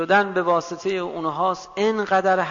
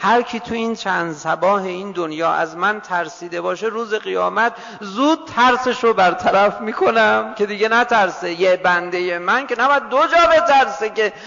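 impassioned preaching; 4 semitones higher, 7 dB louder than usual. Acted. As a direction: preach God's promise at full force, rising steadily. Preaching God's promise, building.

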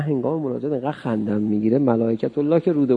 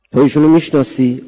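strangers' demand; 11 dB louder than usual. Acted. Strangers questioning at the door.

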